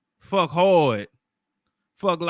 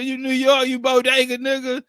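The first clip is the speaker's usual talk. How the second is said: ordinary talk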